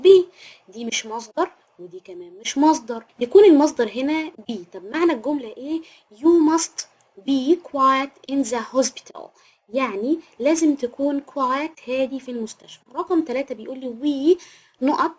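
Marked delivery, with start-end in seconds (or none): 6.1-9.2 slow dictation. Shown as a demonstration. Teacher dictating example sentence.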